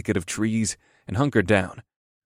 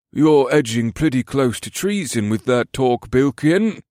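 raised-pitch elf soldier's caution; warning; 4 semitones higher, 3 dB louder than usual. A narrator's reading. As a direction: warn a comrade off loose talk.

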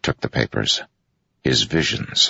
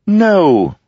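very high, light and louder no; said lightly; 7 dB louder than usual. A narrator's reading. Jester answers the general with a light, very high no. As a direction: toss it off lightly, pitched way up. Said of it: "Wit said lightly".

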